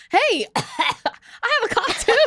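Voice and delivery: high, giggly retold greeting; laughing mock excitement. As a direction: cheer it out high through laughter.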